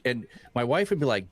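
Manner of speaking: usual speaking voice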